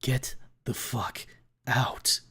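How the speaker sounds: slow, whispered command; Reed orders Trish out in a hiss; cold hiss. A narrator's reading. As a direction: hiss it with menace. Reed coldly dismissing.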